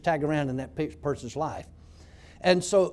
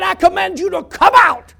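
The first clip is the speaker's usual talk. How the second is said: much louder, higher command to the demon; commanding shout; 12 semitones higher, 13 dB louder than usual. Retold or imitated. Preacher casting the demon out of the prisoner, a commanding shout. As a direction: cast it out with full authority.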